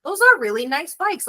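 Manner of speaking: high-pitched wow of admiration; impressed surprise. Retold or imitated. Traveler seeing the Ducatis arrive, surprised and impressed. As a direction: marvel in a lifted, high voice.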